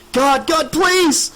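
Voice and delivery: high, loud desperate pleading; terrified wail. A narrator's reading. Tricia pleading in terror as the monster nears, wailing loudly. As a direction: beg in pure panic. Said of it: "Tricia wailed".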